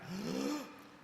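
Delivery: sharp, high imitated gasp; mock shocked gasp. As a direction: gasp as if the workout just hit. Imitates athlete's shocked moment.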